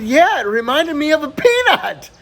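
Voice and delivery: high, loud acted child's report; excited little girl. Little Susie telling her mom, excited.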